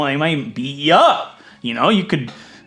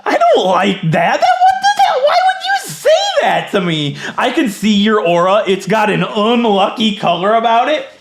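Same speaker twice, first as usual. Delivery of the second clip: high, loud, indignant outburst; loud, laughing disbelief with drawn-out words; mock outrage; 7 semitones higher, 5 dB louder than usual. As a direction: cry out as if personally insulted. Outraged at an insulting game line.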